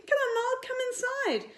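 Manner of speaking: very high coaxing call; coaxing child. Acted. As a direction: coax the cat indoors. Coaxing the cat in.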